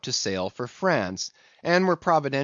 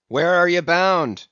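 loud, hard demand with stress on "are"; 5 semitones higher, 7 dB louder than usual. A narrator's reading. Steelkilt to the captain, loud demand. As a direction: demand "Where are you bound" loudly, punching "are". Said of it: "demanded Steelkilt".

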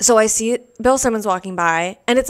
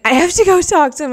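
higher, louder retold urgency; urgent resolve; 9 semitones higher, 6 dB louder than usual. Telling her friend she has to go talk to him, urgent. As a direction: voice jumps up, insisting on going.

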